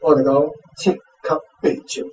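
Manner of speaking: usual speaking voice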